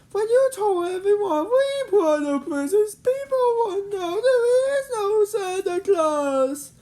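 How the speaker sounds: very high, tearful, slowing wail; sad, near tears. Acted. Carl lamenting to his friends, tearful high wail. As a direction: mourn the loss of Santa. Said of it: "Carl said sadly".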